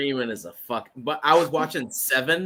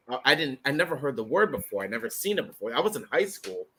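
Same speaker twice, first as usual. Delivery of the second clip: ordinary talk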